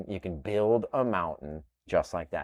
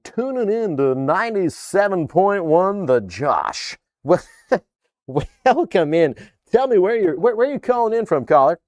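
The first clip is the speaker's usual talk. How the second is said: hammed-up radio host, higher and louder; 8 semitones higher, 7 dB louder than usual. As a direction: announce the station and take a caller like a DJ. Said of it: "I don't know why I always put that voice on".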